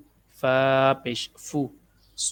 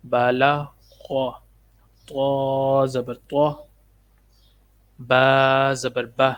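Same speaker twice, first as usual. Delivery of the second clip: slow, drawn-out letter-by-letter drill; measured Qaida teacher's recitation; spelling drill recitation; 3 dB louder, much slower than usual. Shown as a demonstration. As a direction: intone each letter, then its syllable. Drills spelling out Quranic syllables.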